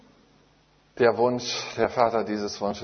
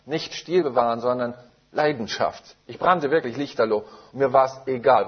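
passionate preaching; 3 dB louder than usual. Real, not acted. Preacher to the congregation about giving everything, fervent. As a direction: preach it like you are burning.

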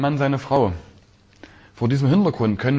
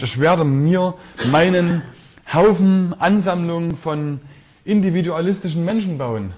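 high and loud, slowed mock of „Haufen“; mocking wrong version; 6 semitones higher, 3 dB louder than usual. Preacher mocking a heap of individualists, raised mock voice. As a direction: scoff at the pile of loners. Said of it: "sagt Jesus auch nicht".

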